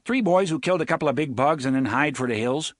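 put-on Cuthbert copying the picket's Nordite accent, quick; fast, faultless Nordite mimicry; mimicked Nordite accent. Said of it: "gave the boy back his own accent faultlessly".

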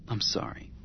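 breathy whisper, almost no voice; whispered I'm sorry; whispered apology. Acted. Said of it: "He said softly".

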